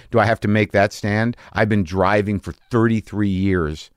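ordinary delivery

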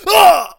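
very high, very loud clutching cry; hammed-up dying yelp; mock heart attack scream. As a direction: shriek like the heart just gave out. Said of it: "a James Gandolfini impression".

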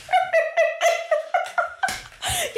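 high, slow words through laughter; laughing hysterically. Real, not acted. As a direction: giggle the words out helplessly.

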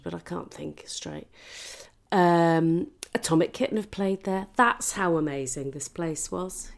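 ordinary talk, nothing marked

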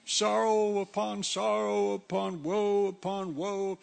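hammed-up moaning complainer; a hint of a wailing sad sack; mock woe. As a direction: whine it like a self-pitying grump, slow. Mocking a complaining moaner.

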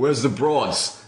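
high brash voice, laughing through it; imitating Mike Demetrio. Mocking the promoter's speech to the team, brash put-on voice. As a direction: ham up a crass American promoter. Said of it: "He like say these speeches about".

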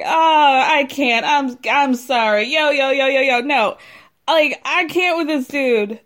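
loud, hammed-up 'I can't' outburst; dramatic exasperation. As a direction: imitate someone dramatically walking out in disbelief. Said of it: "gets up in the most dramatic way".

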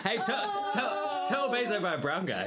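high, stammering through laughter; helpless laugh breaking up the words; laughing protest. Real, not acted. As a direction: fight through giggles to defend yourself.

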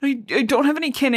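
mock a hurt outburst, lightly; retold anger. Fireheart gets mad at Sandstorm, mildly indignant. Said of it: "so he gets mad".

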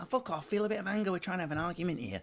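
high, fast mock wife retort; wife snapping back. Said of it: "She'll be like".

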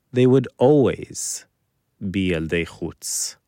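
usual speaking voice

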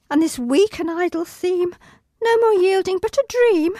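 high, strained recitation; reciting through pain. Acted. Injured child actress reciting Puck's final speech. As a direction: push the lines out while hurting.

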